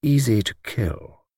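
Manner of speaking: slow, softened chapter-closing line; slow, ominous. Acted. Luke repeats the old lady's claim, slow and ominous. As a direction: let each word sink in slowly.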